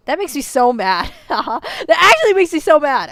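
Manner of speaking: loud, high, laughing anger; so mad. Real, not acted. Viewer admits the scene makes her mad, loud and laughing. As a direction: fume out loud half laughing. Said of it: "I'm so mad".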